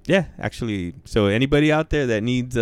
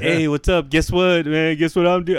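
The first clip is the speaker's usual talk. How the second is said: a higher, eager chatterbox voice; mocking a chatty guy; 8 semitones higher than usual. Mocking an annoying chatty acquaintance, higher eager voice. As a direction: ham up a pushy small-talker who won't stop. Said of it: "Not fucking".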